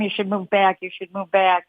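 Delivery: normal talking voice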